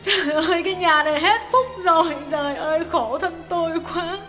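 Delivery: a hint of sobbing as she bemoans her fate; wife's lightly sobbing complaint; sobbing lament. A narrator's reading. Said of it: "Hà ngồi xuống nức nở tiếp".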